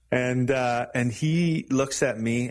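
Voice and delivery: ordinary delivery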